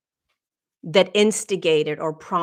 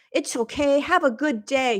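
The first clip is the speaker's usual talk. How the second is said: high, sing-song mock encouragement; mock cheerful platitude; 7 semitones higher than usual. Mocking how others cheer up a friend, high sing-song. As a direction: parody the empty pep talk, pitched up. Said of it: "she didn't try to".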